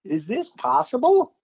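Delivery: high, disbelieving outburst, slowing at the end; astonished. Retold or imitated. Announcer recalling his amazement at the score, high and incredulous. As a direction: blurt it out, then stretch the last questions in wonder. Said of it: "I'm saying, like".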